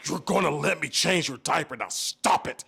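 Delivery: loud, forceful parental telling-off; frustrated order to a toddler, hard and loud; in-your-face scolding. Retold or imitated. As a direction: re-enact a dad losing patience. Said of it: "finally just got in his face".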